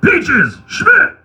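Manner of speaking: high, loud yell of a name; drill sergeant yell. Retold or imitated. Drill sergeant singling out a recruit, high-pitched yell. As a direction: imitate a sergeant yelling at a recruit. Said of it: "yell at you".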